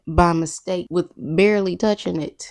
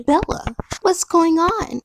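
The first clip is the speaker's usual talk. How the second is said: mock Alice; 11 semitones higher than usual. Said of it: "Alice is like".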